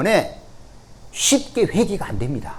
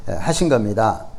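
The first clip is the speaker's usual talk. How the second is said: ordinary talk, nothing marked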